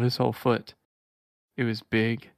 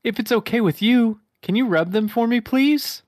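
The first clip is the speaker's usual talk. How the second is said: high, louder pleading hedgehog voice; Sonic voice; 12 semitones higher, 6 dB louder than usual. Acted. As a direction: beg sweetly in a cartoon Sonic voice. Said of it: "he asked".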